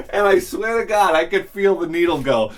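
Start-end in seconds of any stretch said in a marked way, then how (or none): none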